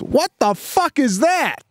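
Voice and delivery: put-on very high-pitched child voice; little girl swearing. Daughter in the back seat blurting out a curse, little-girl voice. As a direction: squeak it out like a startled kid. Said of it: "out of blue and goes".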